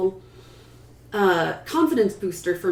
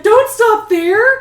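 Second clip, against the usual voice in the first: much higher, louder and slow, a cheering plea; eager shout; 12 dB louder, much slower than usual. Retold or imitated. Audience member urging the reader on, excited shout. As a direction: call out like a fan begging for more. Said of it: "someone was like".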